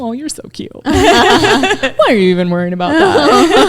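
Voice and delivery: high, laughing and teasing; laughing mushrooms. Retold or imitated. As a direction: tease her like a giggling spirit. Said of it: "the mushrooms laugh at me and they're like".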